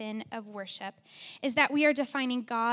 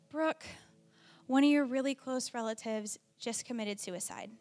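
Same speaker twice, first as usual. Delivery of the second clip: ordinary delivery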